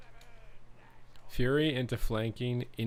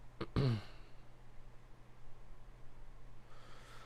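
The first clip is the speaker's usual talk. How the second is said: throat clearing; 3 semitones higher, 11 dB quieter than usual. Real, not acted.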